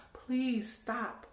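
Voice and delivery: normal talking voice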